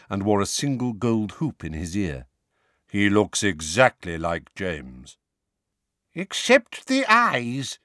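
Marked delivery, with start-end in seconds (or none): 2.9-5.2 lower, slow and calm; deep slow voice. Acted. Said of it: "He had a deep, slow voice". 6.1-7.8 put-on higher, slow wheezing old wizard; wheezy voice. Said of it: "said a wheezy-voiced".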